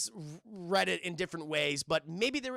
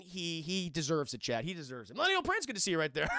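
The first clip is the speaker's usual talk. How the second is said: ordinary talk, nothing marked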